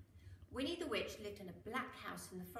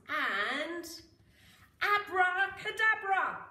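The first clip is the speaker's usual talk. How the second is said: loud, slow, higher abracadabra; magic spell; 8 semitones higher, 12 dB louder, much slower than usual. Acted. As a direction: wave the wand and boom out the spell. Casting a spell.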